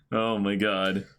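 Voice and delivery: usual speaking voice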